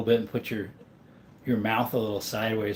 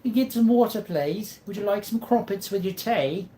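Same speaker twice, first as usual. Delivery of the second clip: high, hammed-up British accent; 9 semitones higher than usual. Offering crumpets with tea, mock British accent. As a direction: pitch it up in a put-on British voice. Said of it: "Best British accent".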